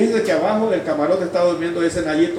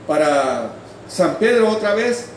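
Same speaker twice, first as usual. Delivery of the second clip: high, projected testimony voice; fervent preaching; 8 semitones higher than usual. Acted. Preacher testifying to the congregation about his conversion, fervent preaching. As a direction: preach it out with raised pitch and force.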